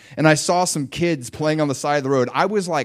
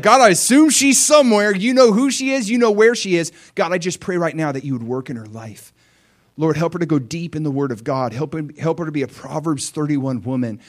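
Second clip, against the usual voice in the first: fervent prayer; 4 semitones higher than usual. Acted. Young man praying for his future wife, fervent. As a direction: pray it out with passion.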